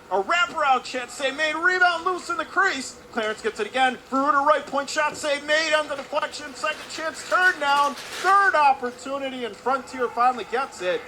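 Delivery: high, loud, urgent hockey call; excited play-by-play. Real, not acted. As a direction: shout the action through a goalmouth scramble. Flurry of shots at goal.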